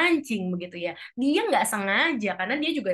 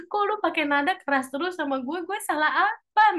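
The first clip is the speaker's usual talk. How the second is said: hurt protest; 8 semitones higher than usual. Acted. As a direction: ask what you did wrong, wounded. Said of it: "walaupun mungkin hatinya meradang". (protes sakit hati yang meninggi)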